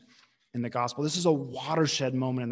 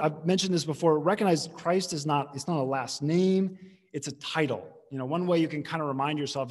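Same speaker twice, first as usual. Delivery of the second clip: ordinary talk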